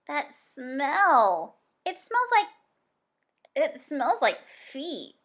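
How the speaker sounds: high, loud, held-nose disgust; disgusted Zoe. Acted. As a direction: squeal it out through a pinched nose. Zoe holding her nose.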